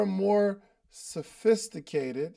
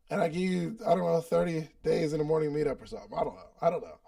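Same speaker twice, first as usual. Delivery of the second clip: normal talking voice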